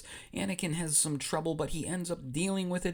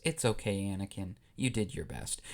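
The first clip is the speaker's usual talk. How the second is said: low, soft reassurance; consoling; 7 semitones lower, 4 dB quieter than usual. Acted. Young queen consoling the disqualified boy, soft and reassuring. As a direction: drop the voice and comfort gently. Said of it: "Padme walks over to Anakin and says".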